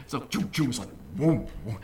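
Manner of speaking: playful choo choo and whoom sounds of hand tools at work; tool noises. Retold or imitated. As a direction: mimic sawing and chopping with quick noises. Imitating old hand tools.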